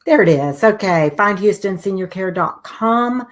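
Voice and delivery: ordinary delivery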